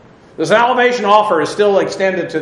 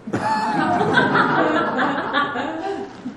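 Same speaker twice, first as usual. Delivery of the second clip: long high laugh; 11 semitones higher, 6 dB quieter than usual. Real, not acted. Laughs at own untested-life wish.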